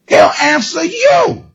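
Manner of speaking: high, slow, crying shout; preaching cry. Acted. Preacher proclaims God answers, high crying shout. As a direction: cry it out to the church. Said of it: "preaching at full force".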